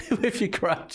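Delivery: laughing. Real, not acted. Repeating it while laughing.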